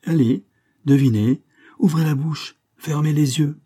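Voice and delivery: a cheerful man commanding a guessing game; joyful command. A narrator's reading. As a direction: coax her into the game with glee. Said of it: "commandement joyeux d'Askin".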